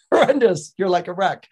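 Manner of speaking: loud, high burst of disgust; appalled. Acted. Elocution teacher appalled by the flower girl's vowels. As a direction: exclaim it like a horrified teacher.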